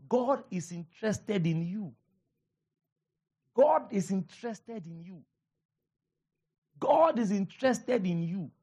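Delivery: repeated three times, driving it home; preaching emphasis. Acted. Pastor assuring his church, preached with emphasis.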